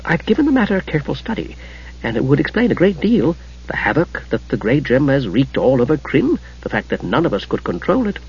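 soft, hushed scholarly musing; softly voiced careful reflection; thoughtful. A narrator's reading. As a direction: explain quietly, as if thinking aloud. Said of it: "Gillian said thoughtfully".

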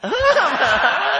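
high hearty burst of laughter; loud high-pitched laugh. Real, not acted. Preacher laughs loudly.

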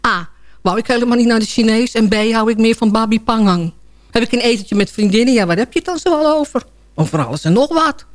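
ordinary talk, nothing marked

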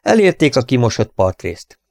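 plain reading voice

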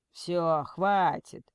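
firm male kidnapper cutting in; man's voice. A narrator's reading. Kidnapper takes the phone back from Lena, man's voice. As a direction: cut it off as the man on the phone. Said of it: "в трубке снова зазвучал мужской голос".

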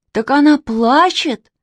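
high, slow exclamation; astonished cry. A narrator's reading. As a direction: cry out as it dawns on you. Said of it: "закричал Костя Реутов".

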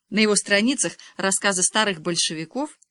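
plain reading voice

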